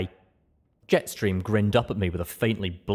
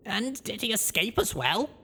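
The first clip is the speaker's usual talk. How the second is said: higher, rising surprised question; young mare voice; 13 semitones higher than usual. Acted. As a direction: Blackjack caught off guard. Blackjack asks smiling.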